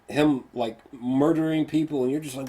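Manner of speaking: ordinary talk, nothing marked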